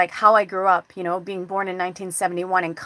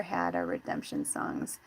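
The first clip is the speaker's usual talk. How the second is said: low intoned lyric; 13 dB quieter, slower than usual. Acted. Reading [name] lyrics.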